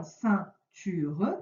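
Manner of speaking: "ceinture" slowly, syllable by syllable; teacher sounding out each syllable of "ceinture"; split into syllables. Shown as a demonstration. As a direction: break "ceinture" into separate beats for a pupil. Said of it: "la première".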